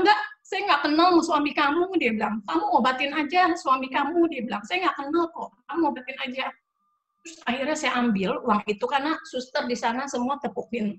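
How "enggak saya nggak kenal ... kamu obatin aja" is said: tegas mendesak. Retold or imitated.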